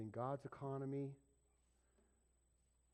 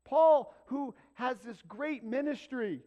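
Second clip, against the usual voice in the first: rising shouted list, each Paul higher and louder; full-force preacher crescendo on Paul; building preached shout; 11 semitones higher, 10 dB louder than usual. Real, not acted. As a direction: thunder out each Paul, climbing. Preacher builds Paul's credentials.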